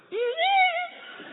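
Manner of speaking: very high squeaky dolphin cry; dolphin squeal. Retold or imitated. Dolphin leaping up beside the boy, high squeaky call.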